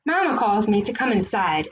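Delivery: usual speaking voice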